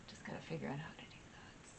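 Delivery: a private little whisper, half to yourself; whispered aside. Real, not acted. Reminding herself about the card links, whispered. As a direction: whisper it to yourself, fast and under your breath.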